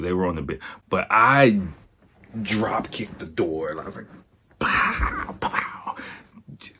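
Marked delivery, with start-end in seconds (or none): 4.6-6.0 voiceless pow sound effects; mock martial-arts blows; imitated kick noises. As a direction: puff out action-movie impact noises. Imitates kicking the door open.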